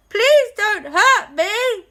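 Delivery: high, loud, slow plea; scared ghost. Acted. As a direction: beg not to be hurt. Ghost afraid of blaster.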